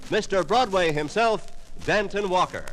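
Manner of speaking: ordinary talk, nothing marked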